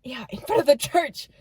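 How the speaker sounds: hushed whisper. Real, not acted. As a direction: keep it to a whisper. Hiding from a strange truck.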